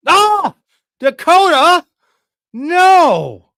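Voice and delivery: high, loud howl of protest; anguished yell. Real, not acted. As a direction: scream at Dakota for stealing him. Dakota takes his tight end.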